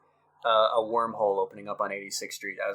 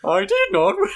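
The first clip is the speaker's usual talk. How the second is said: bad robot impression; 8 semitones higher, 6 dB louder than usual. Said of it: "that's an inside joke".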